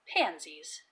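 ordinary delivery, nothing marked

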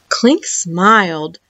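ordinary delivery, nothing marked

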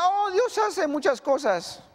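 high, slow pious dodge; evasive reply. Acted. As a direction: answer with a forced churchy tone.

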